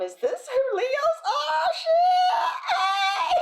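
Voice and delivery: very high, loud, drawn-out whoop; astonished shout. Acted. Reader marveling at Leos' kindness, piercing mock-amazed shout. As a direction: holler it in hammed-up amazement. Acted astonished cheer at discovering Leos.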